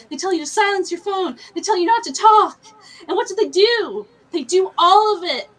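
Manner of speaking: loud, high, exasperated ranting; real indignant tirade; angry rant. Real, not acted. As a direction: build a fed-up case against rude moviegoers. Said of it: "I'm just really angry".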